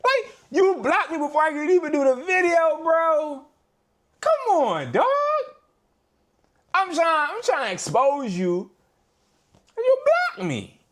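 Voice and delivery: high, pleading complaint; frustrated high-pitched protest; exasperated whine. Real, not acted. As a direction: whine it at the app. Complaining to TikTok.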